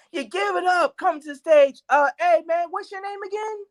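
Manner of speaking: high, announcer-style emcee imitation; hyped host. Club host bringing him on forgets his name, hyped high. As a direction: pitch way up, brassy club host.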